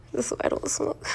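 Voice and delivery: breathy stoned giggle through words; giggling. Real, not acted. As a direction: laugh it out, hushed. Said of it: "I'm high as shit right now".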